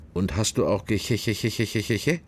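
a lightly voiced priest prying with a stammer; mock stuttering confessor.

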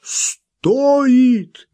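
loud, high, drawn-out exclamation; impassioned outburst. A narrator's reading. Wild man seizing on her worth, exclaiming passionately. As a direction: explode on the word, stretching it. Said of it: "дал волю своему красноречию".

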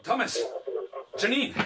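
calling out high and urgent; calling friends to hear the news. Acted. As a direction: call them in alarm.

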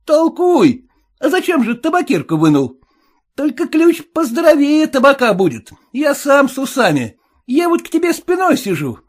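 high suspicious cabbie; cab driver voice. Acted. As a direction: play the sly cabbie, pitched well up.

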